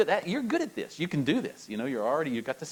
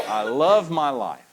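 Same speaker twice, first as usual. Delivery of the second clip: slow and louder, full of joy; preacher leading the repeat again; enthusiastic; 9 dB louder, slower than usual. Shown as a demonstration. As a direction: proclaim it. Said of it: "practice it again".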